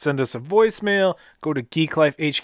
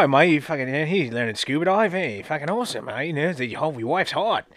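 softer mock Australian patter; fake Australian accent; 4 dB quieter than usual. Scuba instructor chatting up tourists for drinks, fake Australian accent. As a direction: put on a bad Aussie accent. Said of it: "I was rocking the fake accents".

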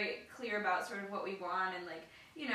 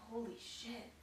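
hushed amazement; 15 dB quieter, slower than usual. Retold or imitated. Friend reacting to news.

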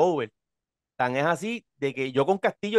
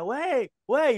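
raised high call to the guard; high hailing call across the arena; 8 semitones higher than usual. Retold or imitated. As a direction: shout it up to get his attention. Calling the guard again.

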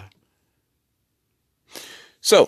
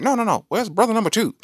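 put-on higher, louder old newsroom editor; 1940s newsroom editor; 6 dB louder, faster than usual. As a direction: play the fast-talking 1940s editor. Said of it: "nineteen forties newsroom".

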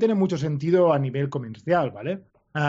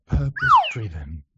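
ASMR voice; 3 semitones lower than usual. Shown as a demonstration. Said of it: "Esto es A S M R".

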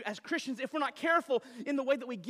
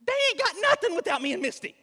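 much higher and louder, real exasperation; exasperated outburst; 12 semitones higher, 7 dB louder, faster than usual. Real, not acted. Father venting to congregation about his kids, exasperated shout.

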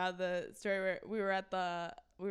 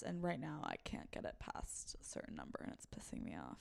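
whispered grumble; 4 semitones lower, 14 dB quieter than usual. Real, not acted. Frustrated aside, whispered.

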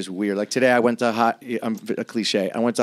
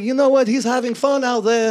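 imagined great-great-grandfather; 10 semitones higher, 4 dB louder than usual. Pretend. Rabbi great-great-grandfather approving of his descendant, high put-on voice. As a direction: play the approving old rabbi, pitched well up. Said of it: "He might be like".

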